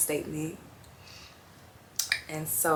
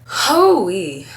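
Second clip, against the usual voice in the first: loud exhale; 4 semitones higher, 13 dB louder than usual. Real, not acted. Exhaling after heavy admission.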